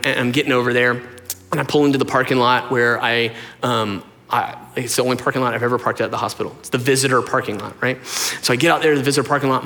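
ordinary talk

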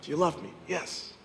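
soft, low, intimate appeal; quiet sermon close. Acted. Preacher speaking softly to listeners at the close. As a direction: draw them in gently.

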